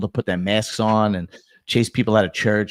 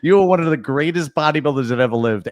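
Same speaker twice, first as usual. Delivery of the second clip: normal talking voice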